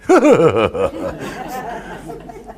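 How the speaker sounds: imitated breathy chuckle trailing away; imitated laugh. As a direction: mimic her laughing as she walks off. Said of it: "she walked off going".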